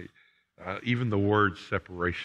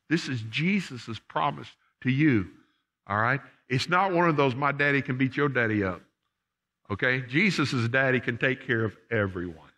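much higher, louder fervent preaching; preaching at full force; 6 semitones higher, 5 dB louder than usual. Acted. Pastor urging his folks that the promise is plain, loud and high. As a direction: lift the whole church with it.

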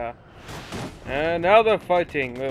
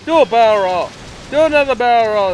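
loud high command; barrel roll shout; 5 semitones higher, 7 dB louder, faster than usual. Acted. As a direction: yell it like a wingman. Quoting Star Fox command.

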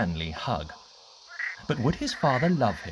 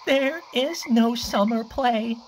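weeping; 10 semitones higher, 3 dB louder than usual. Acted. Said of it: "he wept".

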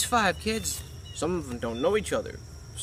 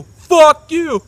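higher, much louder yelled curse; dad screaming at the son who keeps asking; screamed curse; 10 semitones higher, 14 dB louder than usual. Retold or imitated. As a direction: explode it right at the son. Dad answering son, shouted take.

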